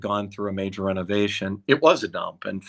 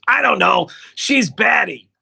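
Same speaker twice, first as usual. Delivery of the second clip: much higher and louder put-on agent voice; Dick impression; 11 semitones higher, 7 dB louder than usual. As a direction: shrug it off as the exasperated agent. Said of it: "I do a better Dick".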